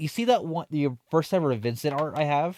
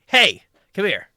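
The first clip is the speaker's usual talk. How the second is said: louder, higher summons; yelling; 6 semitones higher, 8 dB louder than usual. Retold or imitated. Old lady yelling for the cashier to come. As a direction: call her over sharply. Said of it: "She yelled at me".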